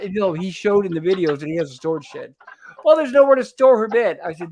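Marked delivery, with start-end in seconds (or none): 2.8-4.2 retold yell. Quoting Bill yelling at her.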